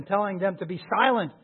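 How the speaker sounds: usual speaking voice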